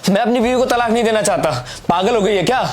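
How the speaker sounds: high-pitched indignant outburst; heated, incredulous protest. Acted. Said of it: "इतना तेज तेज से बोल रहा हूँ".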